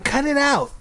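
put-on high little-girl objection; squeaky sister telling him off; annoyed protest. A narrator's reading. Ashley protests being mimicked.

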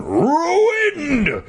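very high, drawn-out hammed-up roar. A narrator's reading. Mister McCall over his ruined melons, roaring. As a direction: howl it at the top of the voice. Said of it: "he roared".

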